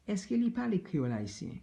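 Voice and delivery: quick high repeat. Shown as a demonstration. Teacher repeats the Creole question fast and high. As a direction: rattle it off up high. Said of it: "does he speak Haitian Creole?".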